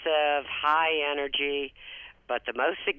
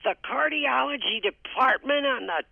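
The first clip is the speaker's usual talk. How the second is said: put-on much higher and louder, an outraged old mother shouting at the staff; angry yell; 12 semitones higher, 5 dB louder than usual. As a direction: yell it like an indignant mother who climbed too far. Mother yelling at hospital staff.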